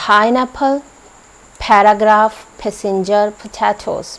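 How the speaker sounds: पाइनएप्पल with a puffed फ-like p; p sounding like ph; aspirated p. Shown as a demonstration. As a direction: breathe out hard on each p, पैराग्राफ as फैराग्राफ. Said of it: "इसकी sound होती है फ".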